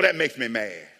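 usual speaking voice

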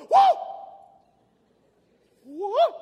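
loud, high cry of alarm; alarmed angel. Acted. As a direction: whoop it out in shock. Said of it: "saw the first one, he goes".

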